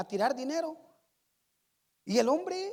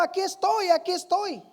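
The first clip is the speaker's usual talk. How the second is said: put-on child shouting; 6 semitones higher than usual. Niño en el público se ofrece, gritando con entusiasmo. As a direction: shout it out like a kid volunteering. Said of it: "Y el niño gritaba".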